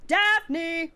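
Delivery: high, loud hollering call; yelling a name. Pretend. Mock parent yells for a missing child. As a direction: holler for the kid like a frantic parent. Mock calling kids' names.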